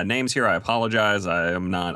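normal talking voice

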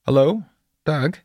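high questioning call. A narrator's reading. Voice at the car window seeks the driver, calling. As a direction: call out to check who is inside. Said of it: "The voice called again".

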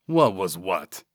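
lightly gargled, falling reply. A narrator's reading. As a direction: play dumb in a gargly character voice. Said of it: "Vernal gargled".

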